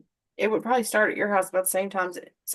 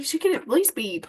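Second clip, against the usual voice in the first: ordinary talk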